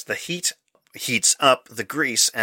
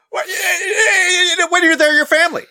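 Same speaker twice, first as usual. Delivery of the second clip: ad slogan voice; 5 dB louder than usual. Pretend. Quoting the Olive Garden slogan.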